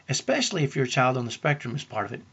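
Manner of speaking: normal talking voice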